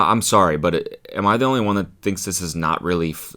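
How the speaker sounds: ordinary delivery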